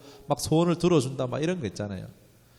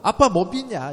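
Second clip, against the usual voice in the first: higher, louder irritated father; annoyed scolding; 8 semitones higher, 8 dB louder than usual. Retold or imitated. Father scolds his doubting child, higher and louder. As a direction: scold the child for not trusting. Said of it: "짜증이 나죠".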